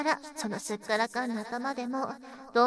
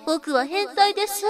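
higher, louder forced confession; mock loud confession; 8 semitones higher, 7 dB louder than usual. Mocking the listener's public confession, loud and higher. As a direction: shout it out as his humiliating line. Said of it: "大声で、".

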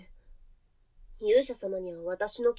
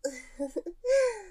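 breathless afterglow laugh; 10 semitones higher than usual. Acted. (息を切らして満足げに笑う)

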